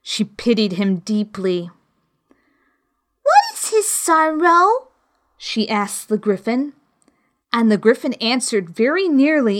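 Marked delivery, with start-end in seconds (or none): 3.2-4.9 put-on high, curious girl's voice; little girl Alice. Alice questioning the Griffin in a high girl's voice. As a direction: ask it as a puzzled young girl. Alice asks about the Turtle.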